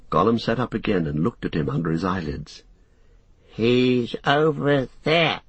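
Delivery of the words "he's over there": high, slow creature cackle; drawn-out gloating croak; cackling. A narrator's reading. Gollum pointing to his master, cackling. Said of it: "he cackled".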